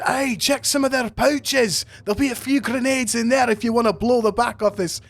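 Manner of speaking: high-pitched bandmate egging them on; Star calling out. Acted. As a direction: call it over in a high, lively voice. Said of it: "and she says".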